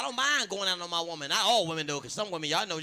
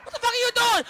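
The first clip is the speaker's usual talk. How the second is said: high, loud, startled shout; shocked yell; 6 dB louder than usual. Retold or imitated. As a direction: catch her in the act and holler. Husband catches wife with toy.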